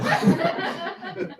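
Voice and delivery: high, airless laugh; a breathy laugh that breaks high; real laugh at the memory; high giggle. Real, not acted.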